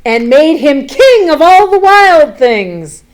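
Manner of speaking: proud storybook climax; triumphant read. A narrator's reading. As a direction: crown him with your voice.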